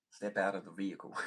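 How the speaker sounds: mock American police order, vehicle without h; hammed-up cop voice with a twang. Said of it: "that added twang".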